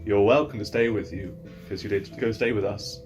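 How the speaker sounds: usual speaking voice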